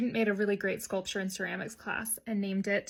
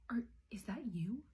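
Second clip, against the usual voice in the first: unvoiced whisper, much softer, rising; whispered accusation; 8 dB quieter than usual. Teacher whispering to a hungover colleague, suspicious whisper. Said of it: "sniffing out margaritas".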